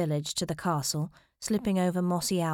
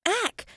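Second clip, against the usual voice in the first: high, short startled "ack"; startled yelp; 3 dB louder than usual. A narrator's reading. Lord is struck by her fist, yelping. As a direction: yelp at a sudden blow.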